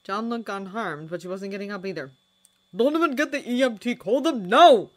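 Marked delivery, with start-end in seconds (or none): 2.7-4.9 higher, louder, fast urgent order; urgent shout. A narrator's reading. Lestrade shouting at Donovan to fetch the paramedics, urgent yell. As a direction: bark the order in a hurry. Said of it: "Lestrade shouted".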